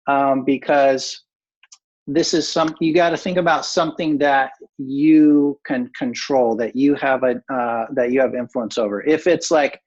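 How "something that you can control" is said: emphatic, stretched "you"; stress on YOU. Real, not acted. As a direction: hit "you" hard and hold it. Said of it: "I put the emphasis on, on you".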